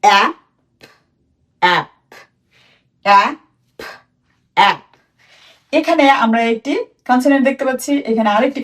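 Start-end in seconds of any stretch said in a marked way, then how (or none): 0.0-4.8 teacher modelling a word for students to copy; drilled pronunciation. Shown as a demonstration. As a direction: model অ্যাপ with an open a sound, like a teacher drilling. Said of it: "এটির উচ্চারণ কি হবে".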